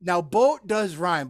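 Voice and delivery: ordinary delivery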